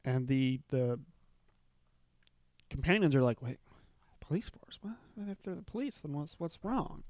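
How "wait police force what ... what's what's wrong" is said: whispered, nervous questions; hushed worried whisper. Retold or imitated. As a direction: whisper it as if scared of being overheard. Companions ask nervously about the police.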